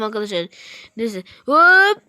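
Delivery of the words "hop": ordinary talk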